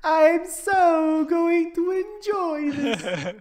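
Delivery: very high, very slow squeaky voice; dolphin voice. Pretend. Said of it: "I can use my dolphin voice".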